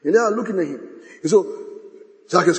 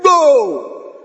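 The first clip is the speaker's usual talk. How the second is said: jeering shout; 9 semitones higher, 7 dB louder than usual. Acted. Mockers' jeer.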